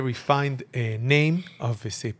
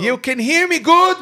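high, loud shouting into the phone; too loud indoors; 14 semitones higher, 8 dB louder than usual. Real, not acted. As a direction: yell so the caller hears. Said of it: "[name], why you yelling?".

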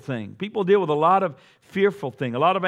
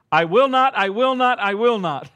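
louder, higher, each "not" hammered; emphatic repetition; 6 semitones higher, 6 dB louder than usual. Acted. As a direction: preach "I will not" with full force. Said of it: "Now listen to this".

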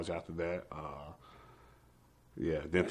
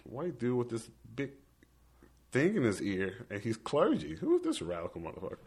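a high, disbelieving retold question; mock outrage at the stranger; high then rushed, half-joking disbelief; incredulous; 6 semitones higher than usual. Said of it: "I'm just like".

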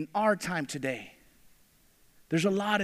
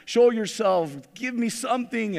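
raised, begging cry to a silent god; pleading call; 4 semitones higher, 5 dB louder than usual. Acted. Prophets of Baal begging their god, raised pleading voice. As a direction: call out to the false god, louder and louder.

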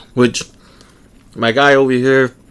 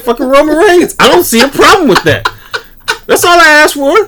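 laughing; 8 dB louder than usual. Real, not acted. Playful teasing between hosts.

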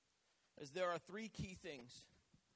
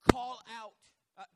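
loud, emphatic preacher's call; 4 semitones higher, 13 dB louder than usual. Shown as a demonstration. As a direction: call it out loudly to the church. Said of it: "And I mean call out".

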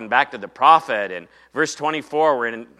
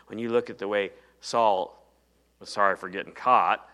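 usual speaking voice

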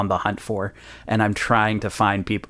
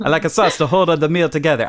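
quick, mock-fancy vague European accent; the invented European accent, hurried; vaguely European accent; 4 semitones higher, faster than usual. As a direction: rattle off a made-up European accent. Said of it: "I don't know what accent that is, vaguely European".

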